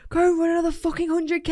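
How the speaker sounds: high rowdy cheer; imitating a friend's wild shout; mock yell. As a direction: hype the runner up. Retold Cal yelling encouragement.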